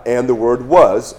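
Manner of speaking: "was" drawn out slowly and leaned on; slow down and hit "was"; stress on was. Shown as a demonstration. Teaching the imperfect tense, slowly stressing "was". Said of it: "again, imperfect tense".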